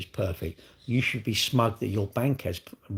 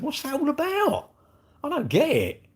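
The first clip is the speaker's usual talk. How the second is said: baffled high. Real, not acted. Baffled by Pikachu moving.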